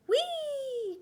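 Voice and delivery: very high laughing squeal; gleeful whee. Acted. Snail sliding on shell, delighted squeal. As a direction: squeal with delight as you slide.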